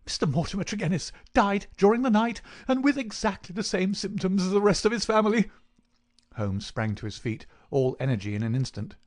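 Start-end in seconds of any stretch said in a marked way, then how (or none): 0.0-5.5 high-pitched, frantic vicar; agitated outburst. A narrator's reading. Vicar brings Holmes news of a death, frantic. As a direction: shoot out the terrible news in panic. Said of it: "he shot out his terrible news".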